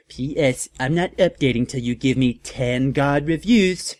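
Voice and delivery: lightly put-on voice of a snotty teen fic author; mock teen author. Reader mocking the fic author's note to her fans, lightly put-on snotty voice.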